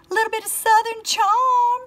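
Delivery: high sing-song Southern lilt; hammed-up Southern twang; put-on Southern accent. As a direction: lift it way up like a Southern belle. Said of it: "Why did I say it like that?".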